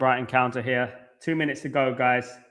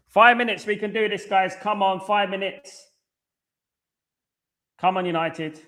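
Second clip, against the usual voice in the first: yelling at a horse race; 6 semitones higher than usual. Real, not acted. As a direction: drive them on, yelling. Urging the team in late minutes.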